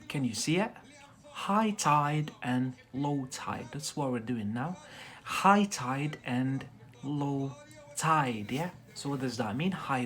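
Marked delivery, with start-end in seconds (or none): 1.3-3.7 slow presentation. Shown as a demonstration. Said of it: "teacher presents new words". 5.2-8.5 very slow model. Shown as a demonstration. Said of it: "teacher repeats new words".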